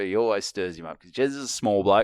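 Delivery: normal talking voice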